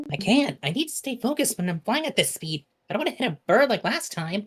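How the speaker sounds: flustered flyer character. Acted. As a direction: protest while concentrating on flying. Reads game character's lines.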